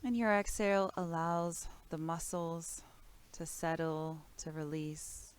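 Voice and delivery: calm, measured body-scan voice with long pauses; guided meditation. Real, not acted. Teacher guides listeners through breath and body awareness, calm meditation voice.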